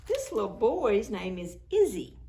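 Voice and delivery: ordinary delivery, nothing marked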